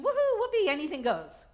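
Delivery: high, loud mock cheer; a giddy anything-goes yelp; mock whoopee. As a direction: squeal it like a careless party-goer. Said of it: "doesn't mean".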